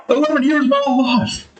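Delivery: high whiny lament; mourning lost years. Retold or imitated. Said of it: "something like".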